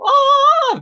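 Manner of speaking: loud high anguished shriek; suffering scream. Shown as a demonstration. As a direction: scream out as if in agony. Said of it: "cuando hay que gritar, como que sufriendo".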